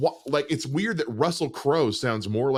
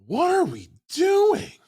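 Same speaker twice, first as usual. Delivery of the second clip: very high, loud, drawn-out cry; real frustrated shout, voice cracking upward in pitch; exasperated outburst; 5 dB louder, slower than usual. Real, not acted. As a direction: wail it in disbelief. Exasperated rant about trailer.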